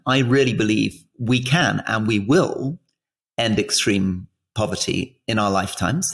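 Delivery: ordinary talk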